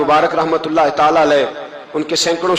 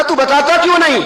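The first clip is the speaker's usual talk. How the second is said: urgent demand; 11 semitones higher than usual. Acted. Wife demands answer, story.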